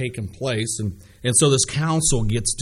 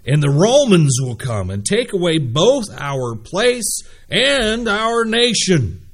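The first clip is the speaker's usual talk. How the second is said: loud, emphatic council's outcry; forceful scripture reading; 5 semitones higher, 7 dB louder than usual. Acted. Chief priests and Pharisees fretting in council, read forcefully. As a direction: read it out forcefully, drawing out key words.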